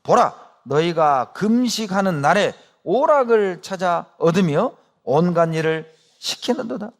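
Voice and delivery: measured, solemn reading of the verse; scripture reading. Acted. Preacher reading Isaiah to the congregation, solemn. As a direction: read the passage aloud with weight.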